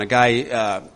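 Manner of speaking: ordinary talk, nothing marked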